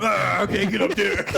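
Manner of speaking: joking voice of the Thing, stuck on the ground; mock the Thing. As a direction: play a big lug who can't reach the fight.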